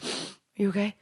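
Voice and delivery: soft, hushed mock concern; acting out a timid night-time check; retold gentle check-in. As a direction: softly imitate a worried girlfriend. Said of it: "and I was like".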